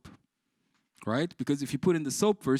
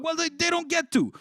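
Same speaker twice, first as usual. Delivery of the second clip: indignant retort; 11 semitones higher, 5 dB louder than usual. Retold or imitated. Arguing with chef.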